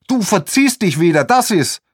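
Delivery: high, loud snapping; shrill scolding. Acted. Protester tells the man to clear off, snapping high. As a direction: scold him off sharply. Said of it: "keift sie".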